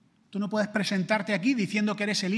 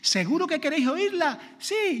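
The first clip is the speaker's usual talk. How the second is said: put-on friend and kids; 10 semitones higher, 4 dB louder than usual. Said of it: "los niños gritaban".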